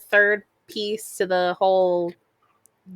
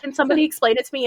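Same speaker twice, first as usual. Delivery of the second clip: rushed innocent question; 5 semitones higher, much faster than usual. Retold or imitated. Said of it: "she still just like".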